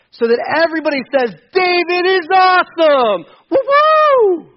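high, loud cheer, half in jest; mock triumphant cheer. Preacher mocks a self-glorifying version, gleeful mock cheer. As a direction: ham up a boastful crowd cheer.

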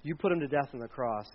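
a low, understated Peter; Peter speaking. Retold or imitated. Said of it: "and he said".

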